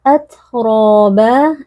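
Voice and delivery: "Atroba" chanted slowly, t checked with slight air; held t, long vowels. Shown as a demonstration. Teacher demonstrates sukun ta, "Atroba" slowly chanted. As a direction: stop briefly on the t of "Atroba", then draw out "roba". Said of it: "Suara kita tertahan tapi ada sedikit".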